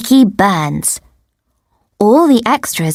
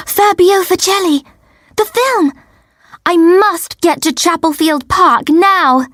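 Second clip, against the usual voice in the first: high-pitched, gasping excitement; urgent, higher-pitched burst of excitement; sudden excited realisation; 8 semitones higher than usual. A narrator's reading. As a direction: gasp it out as if suddenly remembering. Narrator suddenly remembers her film appointment.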